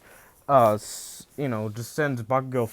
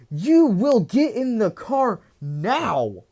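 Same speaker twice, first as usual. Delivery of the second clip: higher, louder, slow stern order; angry command; 9 semitones higher, 5 dB louder, slower than usual. Retold or imitated. Father orders his son home, loud slow angry command. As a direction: lay down the law as a furious father. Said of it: "Hasashi's like".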